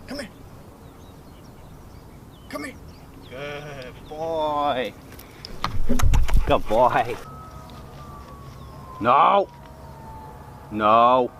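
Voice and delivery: low, soft commands to a dog; talking to a dog. Real, not acted. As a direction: quietly correct and praise the dog. Shock mode recall and sits.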